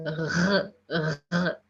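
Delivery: "R" as a bare low throat gargle; gargling sound. Shown as a demonstration. Teacher isolates the French R sound, gargling. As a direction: gargle "R" alone, with no vowel before it. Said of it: "A gargling sound is there".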